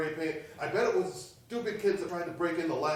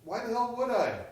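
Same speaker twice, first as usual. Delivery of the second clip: indignant; 7 semitones higher, 4 dB louder than usual. Acted. Friend accused of the break-in.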